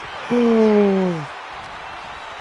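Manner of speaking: a long high gasp of delight; excited gasp. Retold or imitated. Speaker seeing the Shiba at work, a high excited gasp. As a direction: gasp at the sight of the fluffy dog.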